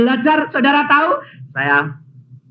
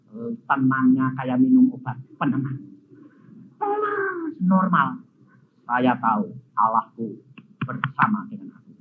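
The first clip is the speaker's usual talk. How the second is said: usual speaking voice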